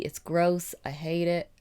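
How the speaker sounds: ordinary delivery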